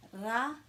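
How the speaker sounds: "Ra" with a slightly rolled R; lightly rolled R. Shown as a demonstration. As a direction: give the R in "Ra" a small roll. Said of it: "rolling your R a little bit".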